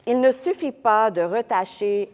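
French text read with French vowels and uvular r. Shown as a demonstration. Teacher reading the French scholar to the class. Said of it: "I'm gonna read the French".

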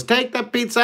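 high, pleading delivery guy; frantic pizza guy. Retold or imitated. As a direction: beg him to just take it. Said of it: "He goes".

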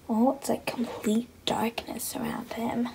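hushed aside to the viewers; whisper. Real, not acted. Reading to the camera, whispering about the dark room. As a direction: breathe it like a secret in the dark. Spooky dark bedroom commentary.

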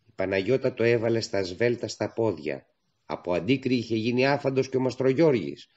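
usual speaking voice

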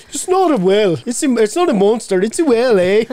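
deep mock Irish accent, imitating daughter. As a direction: drop the voice low in a mock Irish lilt. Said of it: "she drops four tones, goes down".

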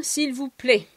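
ordinary talk, nothing marked

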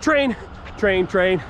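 excited. Real, not acted. Spotting a train mid-run.

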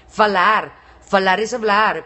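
FALAR said loud and separate; emphatic clarification. Real, not acted. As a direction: spell out FALAR loudly for them. Aclara la palabra malentendida. (FALAR recalcado, fuerte)

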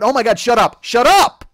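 loud, high shut-up, building to a shout; exasperated outburst. Acted. Comedian cutting off his own mock politician rant, exasperated shout.

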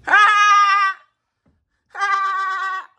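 loud high taunting "Ha!"; mocking laugh. Real, not acted. Prankster taunting his victim with a loud mocking laugh. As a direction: bark out two gloating laughs at her.

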